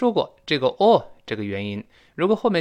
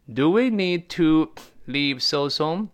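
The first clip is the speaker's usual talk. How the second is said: higher, slow question with spaced words; unhurried repeat, pitched up as a question; slow model reading; 4 semitones higher, slower than usual. Shown as a demonstration. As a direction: draw out each word for learners. Teacher repeats example slowly.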